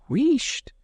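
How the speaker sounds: sharp high shushing; hushing maid. A narrator's reading. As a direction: hush him urgently.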